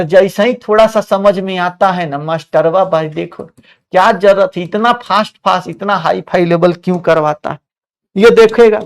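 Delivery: usual speaking voice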